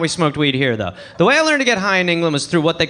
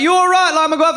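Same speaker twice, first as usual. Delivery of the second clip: mock cheery call; 8 semitones higher than usual. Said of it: "They'd be like".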